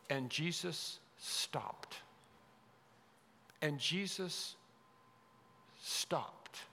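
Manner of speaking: soft, slow, with a weighty pause; hushed awe. Acted. Preacher dwelling on wonderful verse, hushed and deliberate.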